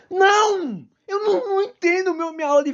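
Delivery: high-pitched, whiny outburst; exasperated protest. Acted. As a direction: protest in a high, wailing rant. Rants back at the splitters.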